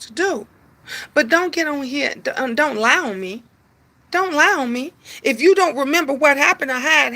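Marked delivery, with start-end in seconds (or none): none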